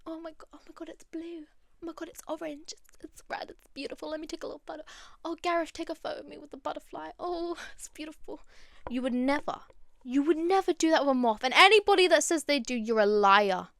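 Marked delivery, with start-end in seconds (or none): 0.1-8.4 soft, high, breathy mock gushing; gushing butterfly fan. Mocking people cooing over a butterfly, soft high gush. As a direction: coo like a dazzled tourist. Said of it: "they'll perch on you and".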